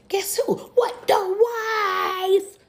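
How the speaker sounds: the jaw-drop moment; shocked reveal. Real, not acted. Reveal of the first wife.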